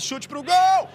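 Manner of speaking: long held goal scream; explosive goal commentary. Real, not acted. Goal call after golaço. (narração explosiva de gol)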